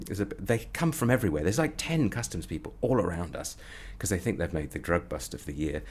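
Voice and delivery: normal talking voice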